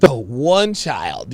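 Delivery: slow mock creaky voice; exaggerated slow vocal fry; imitating vocal fry. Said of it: "you hear the vocal fry".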